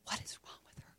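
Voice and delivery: hushed whisper of "What is wrong with her?". Retold or imitated. Office ladies murmur about the crying teacher, whispered. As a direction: whisper it like a gossiping aside. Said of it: "like, going".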